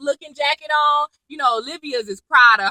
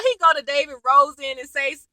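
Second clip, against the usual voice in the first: ordinary delivery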